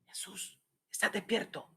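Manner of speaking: breathy hushed wake-up call; soft whisper. Retold or imitated. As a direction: whisper it gently. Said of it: "no me los imagino diciéndole".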